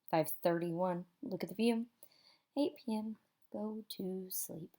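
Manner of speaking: ordinary delivery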